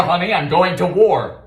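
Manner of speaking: ordinary talk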